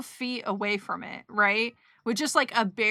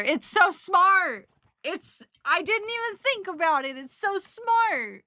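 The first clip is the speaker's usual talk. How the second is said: amazed excitement; 6 semitones higher, 4 dB louder than usual. Real, not acted. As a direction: cry out, floored by a clever idea. Amazed at a clever packing tip.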